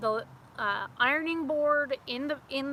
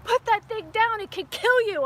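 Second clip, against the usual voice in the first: louder, higher urgent command; stern dad; 9 semitones higher, 8 dB louder than usual. Retold or imitated. Dad ordering her to drop the snake, loud urgent command. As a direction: order her like a scared father. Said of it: "He's like".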